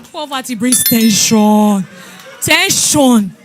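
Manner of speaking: slow and loud, leaning on "tension"; preached emphasis. Real, not acted. Preacher drives the lesson home to the church, slow and loud. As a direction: hammer the point home slowly.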